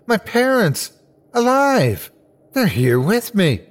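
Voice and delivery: high, amazed joy; overjoyed. A narrator's reading. Boy finds his parents alive, high and overjoyed. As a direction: exclaim it high in happy disbelief. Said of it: "A happiness filled my soul".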